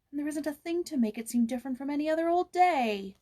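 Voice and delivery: put-on grumbling girl. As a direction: complain about the warm holiday. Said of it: "her grumbling".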